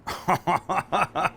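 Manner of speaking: real rhythmic chuckle; laugh. Real, not acted. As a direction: burst into a run of ha-ha laughter. Laughs at the finished food.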